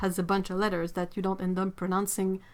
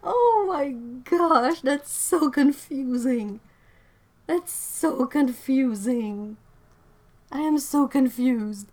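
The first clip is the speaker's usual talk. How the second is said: drawn-out, loud, higher wail of confusion; exasperated wail; 5 semitones higher, 5 dB louder, slower than usual. Real, not acted. Streamer baffled by an anglicised French name, exasperated wail. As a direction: wail it slowly in comic despair. Comic despair after laughing fit.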